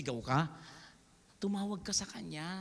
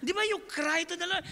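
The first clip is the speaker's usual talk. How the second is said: normal talking voice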